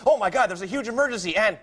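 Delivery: high-pitched fake emergency alert; hammed-up urgent app voice; mock computerized voice. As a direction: play an automated caller feigning alarm. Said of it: "Is there, like, a computerized voice?".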